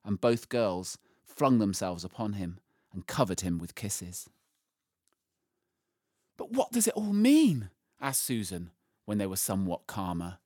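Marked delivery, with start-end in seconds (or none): 6.4-7.7 put-on puzzled girl. A narrator's reading. Susan asks Aslan to explain, puzzled. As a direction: ask it high, in wonder.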